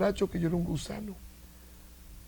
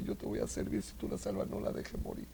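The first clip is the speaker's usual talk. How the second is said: whispered pleading to God; surgeon's whispered prayer over a dying patient; 7 dB quieter than usual. Retold or imitated. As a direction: breathe the plea without voice.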